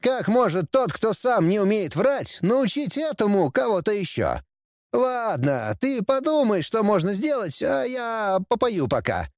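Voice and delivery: put-on high-pitched teasing young heir; bratty prince, scornful. A narrator's reading. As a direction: needle your father in a high voice. Prince lectures and teases father. (насмешливый принц, высоким голосом)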